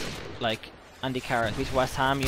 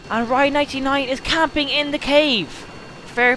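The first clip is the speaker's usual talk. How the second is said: loud, very high mocking call-out; high-pitched exclamation; 12 semitones higher, 7 dB louder than usual. Real, not acted. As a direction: announce it in a raised, squealing voice. Calling out a camping player.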